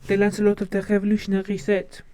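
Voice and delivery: put-on French-accented stage line; lightly put-on French accent, showy delivery; Lafayette. Quoting a favorite Hamilton character, in a French accent. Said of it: "from Hamilton when he goes".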